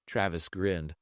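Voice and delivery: plain reading voice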